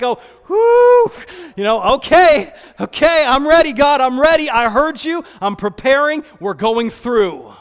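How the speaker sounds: a loud, flustered, rallying gasp; overwhelmed. Retold or imitated. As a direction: shaken but getting ready. Overwhelmed response to God.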